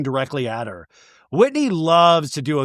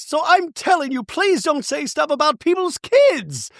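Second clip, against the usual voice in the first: much higher, fragile put-on voice; Bronwyn voice; 12 semitones higher than usual. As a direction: play her as close to tears. Bronwyn pleading.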